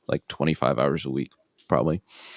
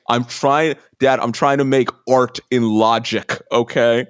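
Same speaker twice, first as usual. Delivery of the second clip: raised, whiny-teen style mock protest; defensive son; 6 semitones higher, 4 dB louder than usual. Jobless son defends his music to dad, indignant. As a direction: plead your case, higher and indignant.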